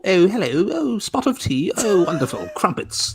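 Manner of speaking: high, hammed-up English toff accent; mock English accent. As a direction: lampoon your co-hosts' English accent in a high pitch. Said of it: "That's how you all sound to me".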